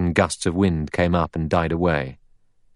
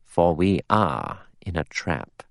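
slow, deliberate and weighty; slowly; 3 dB quieter, slower than usual. A narrator's reading. Lombard tells the others they are trapped, slowly. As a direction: spell it out slowly, word by word. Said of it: "He said slowly".

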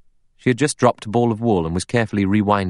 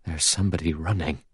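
hushed, understated fear; odd, scared voice; 6 dB quieter than usual. A narrator's reading. Butler stops with the plates, in a scared voice. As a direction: drop to a soft, frightened near-murmur. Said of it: "He said in an odd, scared voice".

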